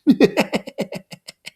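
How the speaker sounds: high, loud cackling laugh; a hollow parody of laughter; mocking demon laugh. Lord of the Flies laughing at Simon, cackling. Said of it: "The laughter shivered again".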